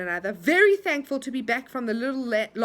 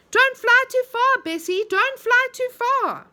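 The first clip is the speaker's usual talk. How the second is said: high, louder call across the air; 12 semitones higher, 8 dB louder than usual. A narrator's reading. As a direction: call up to her as she flies. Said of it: "Franny called out to her".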